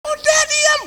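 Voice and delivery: very high, loud shrill order to shut up; angry shout. Retold or imitated. Istri menyuruh diam dengan marah. (teriakan marah melengking)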